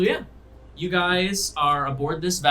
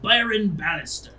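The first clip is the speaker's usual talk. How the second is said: higher-pitched roll-call announcement; calling out names; 7 semitones higher, 5 dB louder than usual. Acted. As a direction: announce the next passenger's name. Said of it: "person he calls out".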